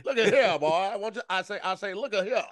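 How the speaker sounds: high-pitched blustering rooster send-up; Southern cartoon bluster; Foghorn Leghorn.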